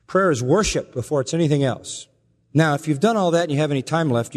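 ordinary talk

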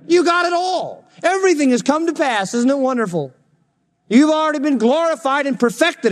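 very high, sustained mock rapture; fake-congratulate them in soaring pitch; sarcastic. Paul to Corinthians already in the millennium, sarcastic. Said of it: "Well, that's sarcastic".

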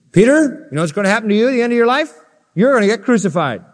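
high, fast excited Jesus voice; voicing Jesus. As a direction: play Jesus teasing Peter with good news. Preacher voicing Jesus to Peter.

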